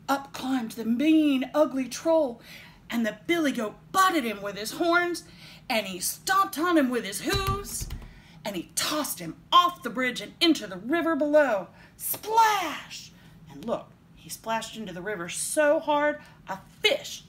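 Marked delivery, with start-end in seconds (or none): 0.1-11.7 loud, raised, dramatic storytelling; excited narration. A narrator's reading. Reader telling children of the fight, excited. 12.0-13.1 high, loud burst; exclaimed splash. A narrator's reading. Reader sounding out the troll's fall, exclaimed.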